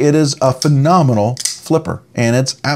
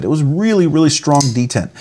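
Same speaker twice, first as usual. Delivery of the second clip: ordinary delivery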